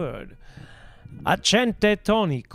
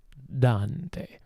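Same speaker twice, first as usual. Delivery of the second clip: usual speaking voice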